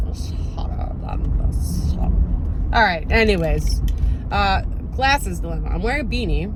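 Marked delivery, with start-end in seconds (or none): 0.1-2.7 put-on muddled Scottish accent. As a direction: drag it out in a mangled Scottish croak.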